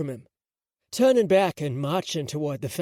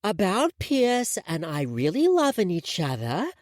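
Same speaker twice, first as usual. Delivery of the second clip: lightly put-on little-girl voice; a hint of a strange small child; mildly childish sing-song; weird childlike voice; 5 semitones higher than usual. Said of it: "in this weird, childlike voice".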